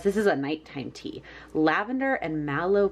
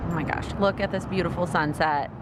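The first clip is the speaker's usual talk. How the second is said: ordinary talk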